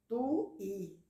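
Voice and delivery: "tu, i" em duas emissões separadas; pronúncia com hiato. Shown as a demonstration. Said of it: "Há pessoas que pronunciam". ("tu, i" partido em duas sílabas)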